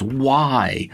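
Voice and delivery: mock a big outcry without much added volume; retold mega voice. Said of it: "in a mega voice".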